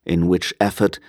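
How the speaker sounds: ordinary delivery, nothing marked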